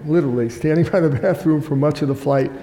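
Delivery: normal talking voice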